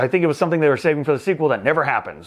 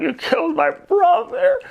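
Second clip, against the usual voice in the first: very high, slow mock lament; mock anguished wail; 14 semitones higher, slower than usual. Kate seeing her dead brother, hammed-up wail. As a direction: wail it like a melodramatic heroine. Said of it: "Kate Beckinsale's like".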